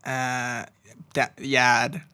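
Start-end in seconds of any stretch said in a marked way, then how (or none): 1.1-2.0 dropped-r Boston yard; Boston accent. Pretend. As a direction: clip it like a Boston local. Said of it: "like Boston accent?".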